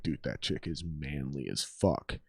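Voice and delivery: hushed, slow aside behind someone's back; mock whisper. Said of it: "then they might even whisper".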